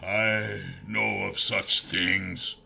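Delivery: soft, very slow throaty voice; throaty elven king. A narrator's reading. Cursed king answers the hero, slow soft throaty voice. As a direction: draw it out softly from the throat. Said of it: "His voice was throaty, but still elven".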